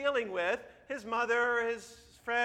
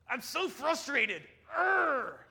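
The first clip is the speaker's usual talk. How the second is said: high, drawn-out frustrated cartoon voice; Daniel Tiger; 6 semitones higher, 3 dB louder, much slower than usual. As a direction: stretch the words, end in a loud growl. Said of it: "It's Daniel Tiger. He says".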